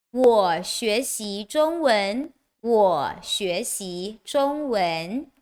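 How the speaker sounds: slow, careful Mandarin example sentences; deliberate language-teacher demonstration; slow model reading. Shown as a demonstration. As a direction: draw out each word for learners to follow.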